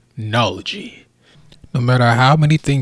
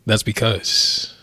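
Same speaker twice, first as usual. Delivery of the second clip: usual speaking voice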